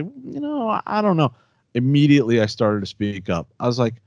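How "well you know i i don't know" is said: timid hedging. Retold or imitated. Telling the group how he usually hedges, timid and high. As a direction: imitate your usual meek hedge, pitched up. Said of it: "and then I'll say".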